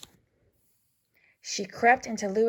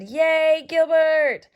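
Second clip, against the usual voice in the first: cheering; 8 semitones higher, 7 dB louder, much slower than usual. Acted. Patty cheering Gilbert's tooth.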